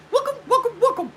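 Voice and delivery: quick falsetto mimicry of her greeting; fast high imitation. Showing viewers how fast she goes, high falsetto imitation. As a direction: fire it off in a very high voice. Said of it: "She does it really fast".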